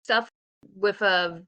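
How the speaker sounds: ordinary talk, nothing marked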